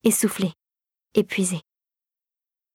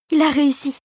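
higher-pitched, excited exclamation; enthusiastic; 4 semitones higher than usual. A narrator's reading. Clara celebrates Philippe's success, excitedly. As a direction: say it thrilled, pitched up. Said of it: "s'enthousiasma Clara".

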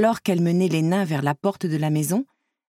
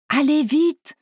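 high-pitched urgent call; 8 semitones higher, slower than usual. A narrator's reading. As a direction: call them over, pitch right up. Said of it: "les appela du haut du pont".

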